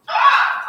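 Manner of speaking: loud, high cry of disbelief; exclaimed yell. Real, not acted. Storyteller reacting to the plot twist, loud yell. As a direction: yell out "ah" at the top.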